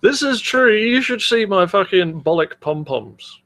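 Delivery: ordinary talk, nothing marked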